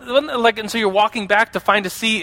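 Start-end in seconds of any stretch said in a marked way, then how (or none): none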